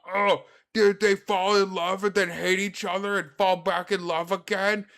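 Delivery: loud, high airhead voice; mock dimwit gushing, loud; stupid brain voice. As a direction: ham up a clueless inner voice. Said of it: "That's what my stupid brain does".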